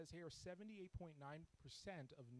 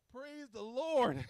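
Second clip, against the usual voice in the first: joyful praise; 11 semitones higher, 18 dB louder, much slower than usual. Retold or imitated. Relief after prayer answered.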